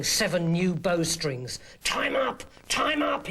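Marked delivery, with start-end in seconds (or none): none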